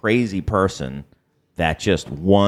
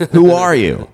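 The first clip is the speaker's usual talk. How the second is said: high, loud retold demand; mock indignant challenge; 10 semitones higher, 7 dB louder than usual. Acting out the character's next breath after the compliment, mock indignant. Retold sudden turn on the other.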